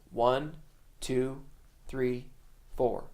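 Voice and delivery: slow count, a gap after each number; measured, unhurried drummer's count; slow tempo count-in. Shown as a demonstration. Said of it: "If the tempo's slow".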